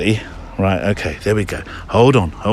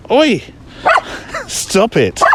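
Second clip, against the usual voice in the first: sharp scold; 9 semitones higher, much slower than usual. Real, not acted. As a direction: snap the dog out of it. Scolding dog jumping.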